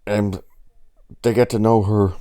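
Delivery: ordinary talk, nothing marked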